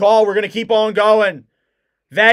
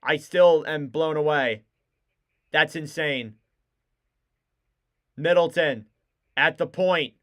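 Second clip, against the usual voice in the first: ordinary delivery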